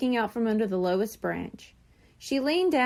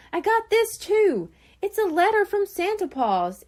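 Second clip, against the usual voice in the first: much higher and louder; excited kid; 9 semitones higher, 4 dB louder than usual. A narrator's reading. Shrimp shows Coral his own letter, high and excited. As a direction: wave the letter with glee.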